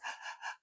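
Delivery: sharp unvoiced gasps for air; quick demonstrated gasping breaths. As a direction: imitate heaving, tearful gasps. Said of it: "gasping and heaving and gasping".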